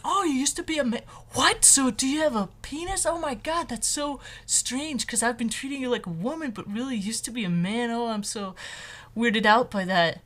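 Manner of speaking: gossipy coworker voice, pitched high; high imitation of a startled coworker. Imitating a coworker reacting to the news, high and shocked. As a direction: gush like a nosy coworker who just found out. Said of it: "Would they be like".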